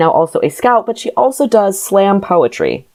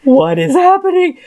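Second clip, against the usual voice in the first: high, bewildered what is happening; baffled disbelief; 11 semitones higher than usual. Retold or imitated. Podcaster recalls first seeing the screenshot, high bewildered disbelief. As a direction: recoil as if you saw something absurd. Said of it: "where I was like".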